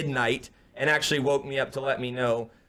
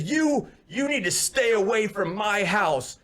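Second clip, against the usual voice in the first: high, slow, emphatic mock threat; imitated angry warning; 6 semitones higher, slower than usual. Storyteller as angry neighbor ordering him away, slow and emphatic. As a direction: imitate a man warning someone off, word by word.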